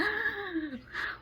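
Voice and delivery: high, hammed-up gasp of a startled kid; imitating the kid's dramatic gasp; mock shocked gasp. The kids after being bitten, a high mock gasp. Kids reacting to a play bite.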